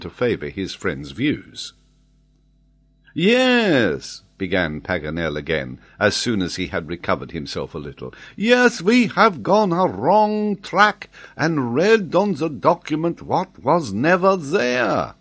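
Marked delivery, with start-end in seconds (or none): 3.1-4.2 high, loud, drawn-out cry; agitated. A narrator's reading. Excited geographer answers his companions, agitated. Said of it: "and more calmly if you can". 8.3-15.1 put-on high, loud, drawn-out French-accented outburst; agitated French geographer. A narrator's reading. Geographer admits the wrong track, agitated. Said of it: "and more calmly if you can".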